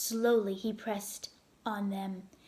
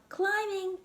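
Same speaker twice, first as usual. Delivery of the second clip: much higher answering call; calling back; 10 semitones higher than usual. Acted. Son answering his father, high call. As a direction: answer your dad up the wall.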